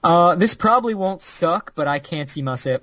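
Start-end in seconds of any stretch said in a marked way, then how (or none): none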